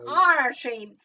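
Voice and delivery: "R" as a loud pirate "arr"; joky pirate cry on "R". Acted. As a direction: growl out "R" like a pirate's arr, then "train". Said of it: "The pirate train".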